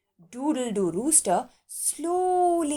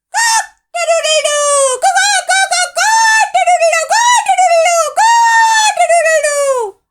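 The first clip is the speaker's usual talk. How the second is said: calling out aloud; 11 dB louder than usual. A narrator's reading. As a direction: crow as loud as you can from hiding. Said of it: "cunningly began calling out aloud".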